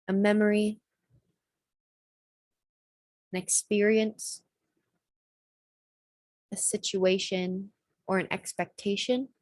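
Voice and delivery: gently, unhurriedly guide listeners inward; guided meditation. Acted. Meditation host leading listeners into a sticky memory, calm guiding voice.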